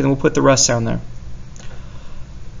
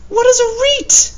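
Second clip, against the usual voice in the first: squeaky high mock question; a cartoonish squeak of disbelief; silly high voice. Teacher mocking the nonsense word "reet" in a high squeaky voice.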